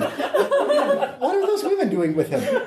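scandalized repeat. Acted. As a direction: clutch your pearls and ask again. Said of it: "repeated with rising emphasis".

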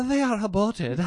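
high, prim mock British accent; haughty British voice over a cruel line; mock Dawkins British. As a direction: sniff it out like a haughty Englishman. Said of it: "I just imagine him talking like that".

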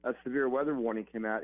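ordinary talk

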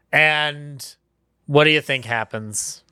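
normal talking voice

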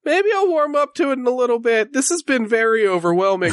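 lightly voiced timid horse; a hint of a nervous young horse; shy talking horse.

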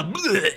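high comic vomit noise; mock retching bleh. Game master corrects the yes, mock retch. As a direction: spew the word out as a retch.

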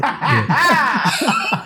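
usual speaking voice

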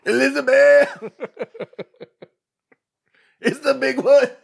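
high, loud, drawn-out mock cry; hammed-up heart attack cry; Fred Sanford impression.

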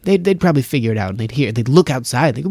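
ordinary delivery

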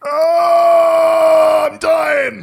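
loud dying wail; wounded soldier gasping. Acted. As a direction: cry out like a dying man. Dying man crying out.

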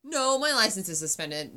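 disbelief. Retold or imitated. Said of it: "disbelief at cop".

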